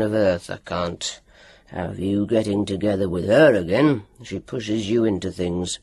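low growled doctor's voice; a low menacing growl. A narrator's reading. Doctor's long speech.

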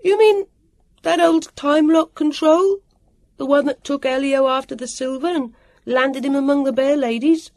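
high, loud questioning shout; loud, high-pitched booming query; booming call. A narrator's reading. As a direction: shout the question across the noise. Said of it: "Sam's voice boomed".

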